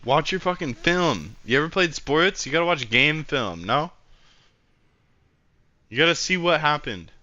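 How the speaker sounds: venting hard at a teammate who won't watch film; frustrated rant. Real, not acted.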